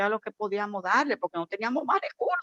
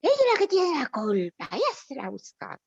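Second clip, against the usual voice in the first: higher, mocking, finger-pointing voice; scornful blaming tone; 6 semitones higher than usual. Church leader mocking a fallen girl, scornful blame. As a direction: sneer out the blame like the gossiping leader. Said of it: "Pero así, ¿eh?".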